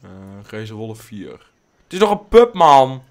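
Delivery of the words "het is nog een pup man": high, loud surprised outburst; loud startled remark; surprised outcry. Real, not acted. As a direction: blurt out that it's only a pup. Surprise the wolf is a pup.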